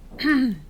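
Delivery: brisk throat clearing. Acted. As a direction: clear the throat before getting to business. Composing self before business.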